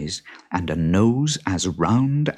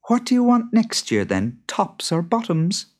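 much higher, lighter wife voice; higher-voiced wife with a plan; clever wife; 13 semitones higher than usual. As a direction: propose the deal brightly. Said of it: "asked Beth".

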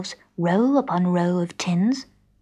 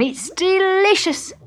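savouring; 12 semitones higher, 4 dB louder, slower than usual. A narrator's reading. Tramp savours the soup.

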